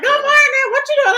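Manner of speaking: high, loud morning holler; loud family greeting. Retold or imitated. Daughter imitating family on the early phone call, loud and high. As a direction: imitate your noisy family's wake-up chatter. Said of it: "We all loud".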